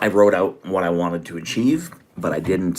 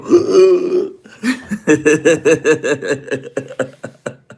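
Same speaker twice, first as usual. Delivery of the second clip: laughing gasp; 8 semitones higher, 6 dB louder than usual. Real, not acted. Can't breathe, winded.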